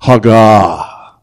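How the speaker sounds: Hagah said loud, HAH-gah, falling; Hebrew word hagah. Shown as a demonstration. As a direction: Hagah with breathy h and first syllable stressed. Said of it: "Say it".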